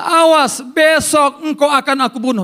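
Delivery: loud, high threatening cry; high-pitched warning with force; menacing threat. Acted. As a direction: threaten loudly, leaning hard on the first word. Voices Jezebel threatening Elijah.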